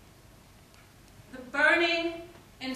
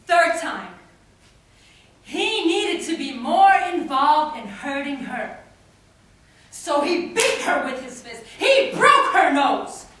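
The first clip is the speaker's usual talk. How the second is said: loud, punched poetic delivery; impassioned outburst; 10 dB louder than usual. Acted. Poet recounting a third, more brutal beating, forceful. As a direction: build in force to a loud final blow.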